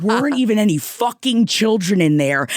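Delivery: ordinary delivery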